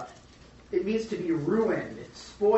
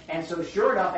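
ordinary talk, nothing marked

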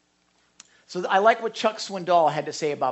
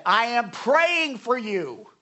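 slow, higher, louder "I am praying for you"; emphatic quote; 4 semitones higher, 4 dB louder, much slower than usual. Retold or imitated. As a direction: stretch it out like caps in a text. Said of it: "and I have said".

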